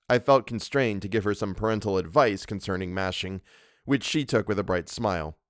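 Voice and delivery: plain reading voice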